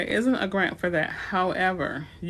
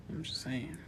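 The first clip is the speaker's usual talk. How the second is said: breathy throwaway whisper; sheepish whispered jab at the viewers; whispered aside; 5 semitones lower, 11 dB quieter than usual. Real, not acted. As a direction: drop it under your breath to the chat. Aside after teasing chat.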